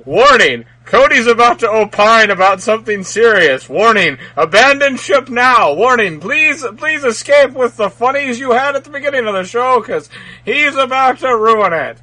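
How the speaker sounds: loud ship computer alarm; calm ship computer. Pretend. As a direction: blare it like a ship's warning system. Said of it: "it could have just been like".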